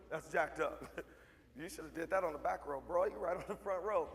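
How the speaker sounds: normal talking voice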